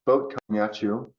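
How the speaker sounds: ordinary delivery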